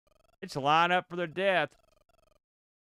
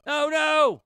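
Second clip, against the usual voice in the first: a real high yell of dismay; dismayed shout; 13 semitones higher, 8 dB louder than usual. Real, not acted. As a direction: cry out as the character dies. Game character falls, he yells.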